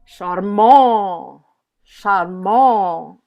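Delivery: Charmant with the final -mant drawn out and weighted; slow Charmant, long stressed ending; stress on last syllable. Shown as a demonstration. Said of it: "They're on their last syllable".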